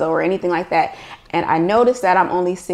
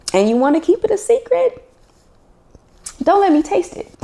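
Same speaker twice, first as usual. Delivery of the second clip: playful mock warning; 11 semitones higher than usual. Joking warning about grandma's secret recipe.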